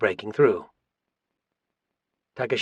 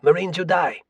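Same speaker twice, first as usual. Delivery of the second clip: high-pitched banzai cry; narrator's raised war cry; battle cry; 6 semitones higher than usual. A narrator's reading. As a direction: cry it out high as a charging attacker's threat. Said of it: "with the cry of".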